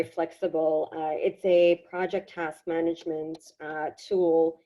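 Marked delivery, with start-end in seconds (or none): none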